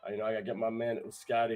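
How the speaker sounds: ordinary talk, nothing marked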